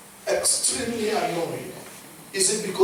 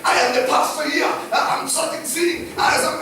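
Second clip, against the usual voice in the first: losing it; 8 semitones higher than usual. Acted. Pastor mimicking a leader proving his calling, losing it. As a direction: plead your authority, worked up and shouting. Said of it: "Moses is already losing it".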